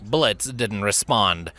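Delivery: plain reading voice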